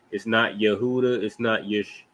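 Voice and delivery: normal talking voice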